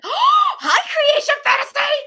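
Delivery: loud high squealed hello; excited shout. Real, not acted. Vlogger suddenly spots a viewer and shouts hello excitedly. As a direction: burst out greeting a fan.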